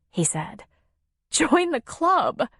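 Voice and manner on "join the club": high, slow, tearful catch; hiccup in voice. A narrator's reading. Nora answers Tom after crying, hiccup in her voice. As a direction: say it through leftover sobs, drawn out. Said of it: "a hiccup in her voice".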